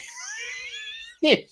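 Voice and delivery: cracking up hard at the memory. Real, not acted. Laughing recalling scaring her sister.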